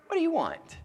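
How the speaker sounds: high-pitched question falling at the end; king to supplicant. Acted. King on his throne to a supplicant, willing offer. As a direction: ask it high, as one able to give. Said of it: "This is a king on his throne".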